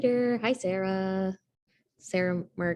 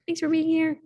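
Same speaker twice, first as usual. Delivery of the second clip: bright welcome; 12 semitones higher, 6 dB louder than usual. Real, not acted. Host welcomes reader.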